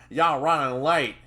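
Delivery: loud Southern scolding; Southern accent growl. Acted. Trainer scolding his late trainee, Southern growl. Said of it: "his southern accent growled".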